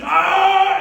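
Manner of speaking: loud, high-pitched drawn-out yell; imitated scream. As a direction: scream like a man straining under a bench press. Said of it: "and he's screaming, he's like".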